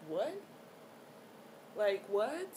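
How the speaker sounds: questioning, sharply rising "what"; retold stunned question; incredulous. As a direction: let each "what" climb in mock disbelief. Retold disbelief at being sent home.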